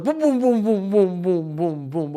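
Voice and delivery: high booms sliding down and fading; mock spin sound. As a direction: mimic the rings spinning fast. Imitating the spinning fitness rings.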